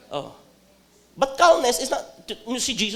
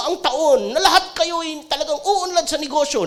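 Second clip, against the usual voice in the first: mock fortune teller; 9 semitones higher, 3 dB louder than usual. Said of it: "Sabi ni Master Hans Kua".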